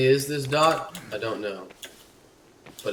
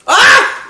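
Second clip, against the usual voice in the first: short, very high loud shriek; sudden scream of fright; startled scream; 18 dB louder than usual. Real, not acted. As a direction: yelp as if jumped. Startled in game.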